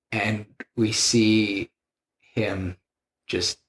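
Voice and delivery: ordinary delivery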